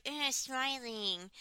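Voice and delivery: slow, soft, frozen-smile imitation; stiff-faced. Friend mimicking a contestant's Botox face, stiff-faced. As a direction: talk through a smile that cannot move. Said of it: "her face doesn't move".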